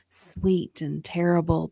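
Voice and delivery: usual speaking voice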